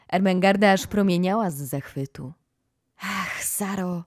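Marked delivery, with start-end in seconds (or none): 3.0-4.0 soft, slow, breathy hush; a slow hushed whisper of delight. A narrator's reading. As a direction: sigh her name in wonder. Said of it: "szepnęła".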